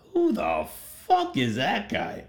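high, disbelieving who the fuck; incredulous. Retold or imitated. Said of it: "you gotta be wondering".

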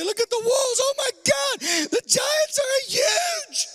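hammed-up awestruck shout; mock amazed gushing. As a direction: gush like a tourist at the walls. Mocking spies' excited report.

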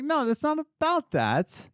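high, slow, insistent; exasperated correction. Retold or imitated. Employee steering boss back to the business, exasperated.

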